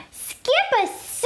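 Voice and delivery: high, shocked mother's question; surprised mother. A narrator's reading. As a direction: gasp it out in disbelief. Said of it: "declared Mama Junebug Jones".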